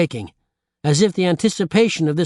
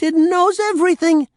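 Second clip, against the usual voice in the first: much higher and louder, thrilled shriek; 4 dB louder than usual. A narrator's reading. Old woman thrilled by the mirror, loud shriek. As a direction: shriek the wonder out. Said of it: "the old woman shrieked".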